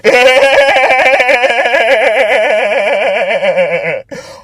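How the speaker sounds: long, high-pitched burst of laughter; high cackling laugh. Real, not acted. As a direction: crack up at the absurdity, long and high. Laughs at combat politics.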